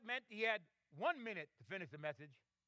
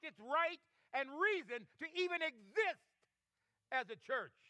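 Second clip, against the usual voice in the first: building, driving sermon cadence; impassioned preaching; 3 semitones higher than usual. Real, not acted. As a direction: hammer each point harder toward the verdict. Preaches at mounting force.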